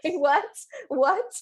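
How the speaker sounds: stunned, rising what; shocked what. Retold or imitated. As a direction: cry out in shocked disbelief. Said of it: "And I'm like".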